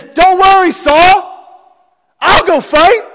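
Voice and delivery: put-on high and loud, bold young hero; confident boast. Young David assuring King Saul, bold and loud. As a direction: toss it off with total confidence.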